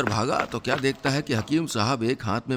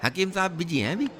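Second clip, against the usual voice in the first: a lightly voiced sly compounder, quick and a bit higher; cheeky compounder; 3 semitones higher, faster than usual. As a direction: rattle off excuses fast like a smooth-talking helper. Compounder Nankau stalls the patient. (चालाक compounder की हल्की सी बदली आवाज़, तेज़)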